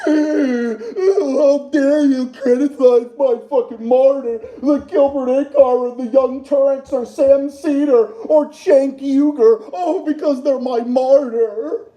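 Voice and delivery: slow, whining put-on voice of an offended fan; mock whiny fan. Streamer mocking critics who defend Gilbert Achcar, whiny slow mock voice. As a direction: drag it out like a sulking defender. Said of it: "the fucking assholes that come out like".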